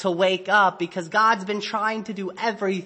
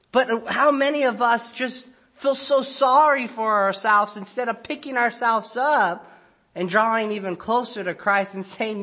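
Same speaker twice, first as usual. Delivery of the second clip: normal talking voice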